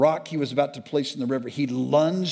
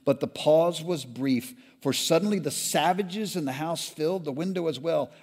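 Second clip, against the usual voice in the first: ordinary delivery, nothing marked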